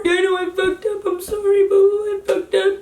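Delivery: high, pleading retold apology; high-pitched, near-crying re-enactment; tearful apology. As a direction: imitate a boyfriend begging forgiveness. Boyfriend's apology voiced in argument.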